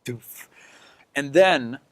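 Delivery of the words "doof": soft, airy whispered impact; a faint thump from far below; imitated distant thud. Game master sounding a plummeting creature's landing, hushed thud.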